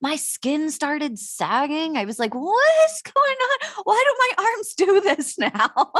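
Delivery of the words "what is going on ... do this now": high-pitched, drawn-out comic alarm; exaggerated panic played for a laugh; mock dismay. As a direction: wail it slowly as if horrified, half laughing. Acting out dismay at aging body.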